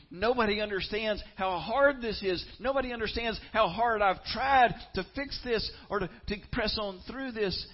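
high, loud desperate outcry; inner scream. Pretend. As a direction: scream it out like someone at the end of their rope. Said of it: "on the inside screaming".

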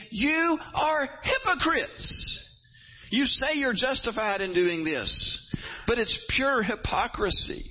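high, slow, accusing; preaching at full force. Retold or imitated. Preacher voicing Jesus calling leaders hypocrites, slow high accusation. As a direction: drag out hypocrites in condemnation. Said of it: "he says again and again".

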